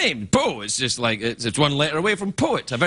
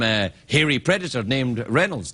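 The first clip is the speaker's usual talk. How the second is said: normal talking voice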